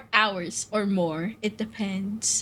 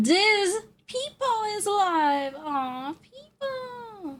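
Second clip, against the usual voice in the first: excited; 11 semitones higher, slower than usual. Real, not acted. Excited to see streamer live.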